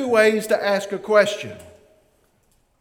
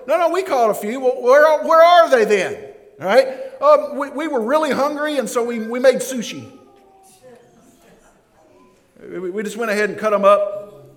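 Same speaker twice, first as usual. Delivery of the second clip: higher, louder protesting fishermen; mock fishermen; 5 semitones higher, 5 dB louder than usual. Fishermen lying to the stranger on shore, higher protest. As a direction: fib like a caught fisherman.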